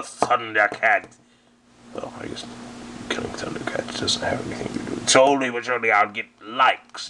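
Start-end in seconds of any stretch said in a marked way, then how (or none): 1.9-5.1 quick whispered aside. Acted. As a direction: mutter it fast under your breath. Muttered aside to audience.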